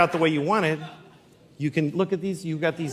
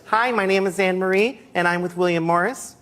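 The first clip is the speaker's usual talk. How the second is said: lightly voiced child actress, slightly raised; sweet, too-mild audition read; little girl auditioning; 3 semitones higher than usual. Little girl at a casting introduces herself, mild child voice. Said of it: "a tape somewhere of little girls".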